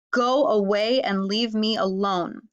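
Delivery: ordinary talk, nothing marked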